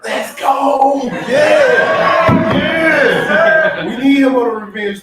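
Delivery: hyped yelling at a press conference; hype shout. Real, not acted. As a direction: fire up the room for a teammate. Hypes teammate's revenge tour.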